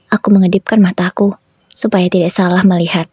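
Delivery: ordinary delivery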